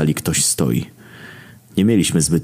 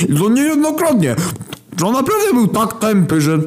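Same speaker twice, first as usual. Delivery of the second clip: high ranting. A narrator's reading. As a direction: keep the outrage going. Famine keeps ranting.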